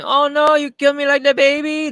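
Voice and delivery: very high, loud mock pleading voice. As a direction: wail like a hammed-up frightened maid. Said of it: "Where I was going".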